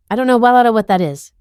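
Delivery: fast, rushed denial; quick denial. A narrator's reading. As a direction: blurt it out fast. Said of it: "he says quickly, too quickly".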